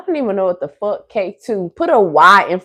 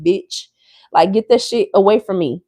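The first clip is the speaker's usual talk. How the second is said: ordinary talk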